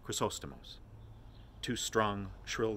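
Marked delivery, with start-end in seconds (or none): none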